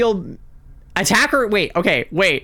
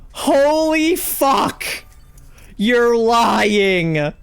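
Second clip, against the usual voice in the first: drawn-out high exclamation; stunned; 7 semitones higher, much slower than usual. Real, not acted. Streamer realizing the team survived, shocked exclamation. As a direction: gasp it out in disbelief.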